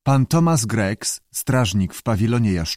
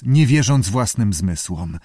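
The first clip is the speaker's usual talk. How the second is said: plain reading voice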